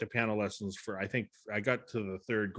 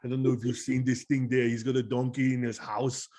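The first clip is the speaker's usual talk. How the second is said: older Austrian action-star impression; mimicked Austrian strongman, chatting on; Arnold Schwarzenegger. Shown as a demonstration. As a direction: ramble along in a thick Austrian accent. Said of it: "So when I do Arnold".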